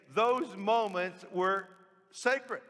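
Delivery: slow down and lift "sacred" like a revelation; slow emphasis. Real, not acted. Preacher stressing the key point.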